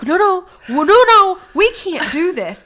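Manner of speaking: high, loud panicked cry. Retold or imitated. Catching a friend tipping backwards, panicked high cry. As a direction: yelp in alarm as she tips back.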